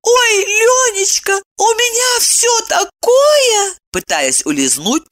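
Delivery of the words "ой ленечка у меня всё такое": very high, slow wifely squeak; vague, evasive wife. A narrator's reading. Wife tries to slip away from husband, vague and high-pitched. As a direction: dodge the question in a tiny high voice. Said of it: "туманно ответила Евдокия".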